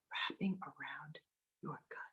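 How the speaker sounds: breathy meditation whisper. Acted. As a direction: whisper it close and soft.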